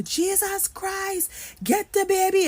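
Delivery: high, urgent Trinidadian man calling out; Trini accent. Retold or imitated. Voice in the dark warns a sleeping mother, Trini accent. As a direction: shout the warning in a Trini accent. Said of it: "So this is a Trini accent".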